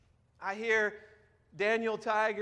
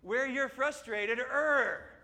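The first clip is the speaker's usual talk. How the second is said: slow, high echo of a growling cartoon; cartoon frustration; 4 semitones higher, 3 dB louder, much slower than usual. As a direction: draw it out into a playful growl. Echoes the cartoon tiger's frustrated growl.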